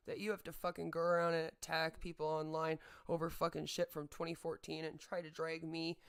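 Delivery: ordinary talk, nothing marked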